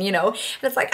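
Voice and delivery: ordinary talk, nothing marked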